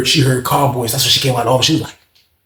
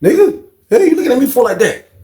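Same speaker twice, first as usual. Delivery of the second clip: confronting shout; 9 semitones higher, 3 dB louder than usual. Retold or imitated. Confronting the staring inmate.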